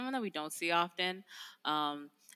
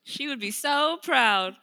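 imagined excited self; 8 semitones higher, 10 dB louder, slower than usual. Retold or imitated. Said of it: "I'm just like".

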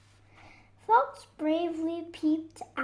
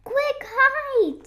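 high, loud alarmed call; urgent warning; 10 semitones higher, 7 dB louder than usual. Acted. Fox warns friends of the bear, urgent high cry. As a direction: send friends running for cover.

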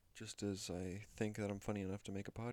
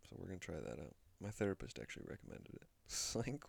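normal talking voice

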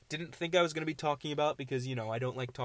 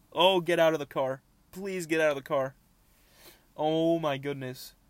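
loud, higher-pitched begging; distressed pleading; 4 semitones higher, 7 dB louder than usual. Real, not acted. Begging a bug to get out of the car, distressed. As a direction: plead loudly, dragging out the exclamations.

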